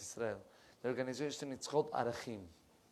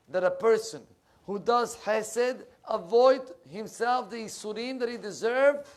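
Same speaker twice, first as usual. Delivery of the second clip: climbing higher and louder, phrase by phrase; preaching at full force; 6 semitones higher, 7 dB louder than usual. Acted. Rabbi building to a climax, preaching hard. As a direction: pound it home like a rising sermon.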